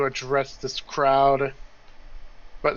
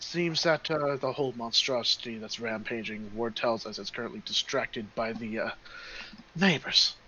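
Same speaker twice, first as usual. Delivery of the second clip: lightly voiced, much softer halfling; halfling companion; 4 semitones lower, 9 dB quieter than usual. Halfling tells of their escape, soft and hesitant. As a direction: explain hesitantly in a quiet, low-key voice.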